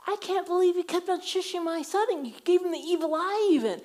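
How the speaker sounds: high, loud furious comeback; retold angry retort. Mom defending her son at the bird show, loud and angry. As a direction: fire it straight back at her. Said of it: "I'm like".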